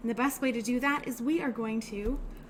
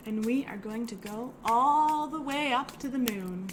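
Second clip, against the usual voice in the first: ordinary delivery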